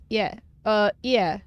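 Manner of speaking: usual speaking voice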